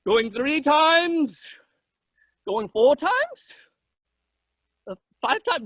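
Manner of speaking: slow, stretched auction count; drawn-out. Acted. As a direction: drag the count out slowly. Joke, dragging the auction count.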